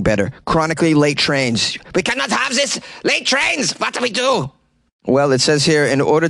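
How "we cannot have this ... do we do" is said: mock panic. Mock panic over late trains.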